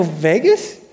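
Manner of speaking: acted incredulous question, rising sharply at the end; mock disbelief. Preacher replaying his reply to an old acquaintance, mock disbelief. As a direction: echo it back as if you can't believe it. Retold disbelief at hearing the city.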